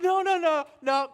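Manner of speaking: emphatic refusal. Retold or imitated.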